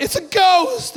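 high, startled yell. Acted. Disciples in the boat spot a figure on the lake, high yell. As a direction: cry out in alarm, pitch leaping up. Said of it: "And they yelled out".

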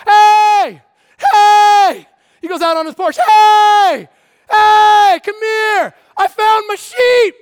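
high, loud joyful yelling from the porch; shepherd hollering that he found his sheep; shouting to neighbors. Acted. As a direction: call out the whole street, overjoyed.

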